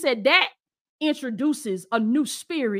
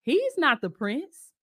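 put-on girl's voice; 8 semitones higher than usual. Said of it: "she said".

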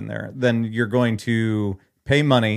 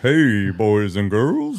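deep Santa voice; 3 dB louder, slower than usual. Acted. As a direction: rumble it out like a crooning Santa.

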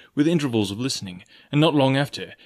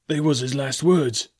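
slowed, mournful pirate; mild moan; 3 semitones higher than usual. Acted. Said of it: "moaned Morgan".

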